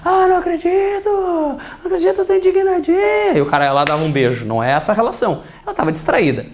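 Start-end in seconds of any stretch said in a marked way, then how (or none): 0.0-3.3 voz aguda de mulher reclamando, fingida. Retold or imitated. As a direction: imita uma mulher indignada e manhosa. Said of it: "a mulher tá lá". (voz fina e dengosa de mulher indignada)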